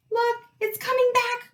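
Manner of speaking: high, loud warning cry; alarmed cry. A narrator's reading. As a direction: cry out that danger is near. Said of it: "Rita cried".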